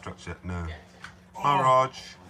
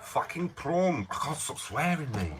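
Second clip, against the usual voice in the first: mock Australian accent; 7 semitones higher, slower than usual. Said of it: "That was South African".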